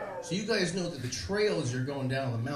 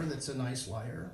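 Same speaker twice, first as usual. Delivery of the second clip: ordinary delivery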